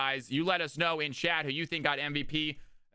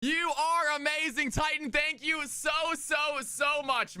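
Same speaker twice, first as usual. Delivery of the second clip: hyped thanks; 8 semitones higher, slower than usual. Pretend. High hyped thanks to donor.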